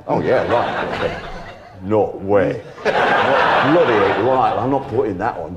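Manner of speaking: scoffing northern bandmate, laughing; gruff Yorkshire refusal through laughter; Yorkshire accent. Retold or imitated. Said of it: "these guys all came from Hull".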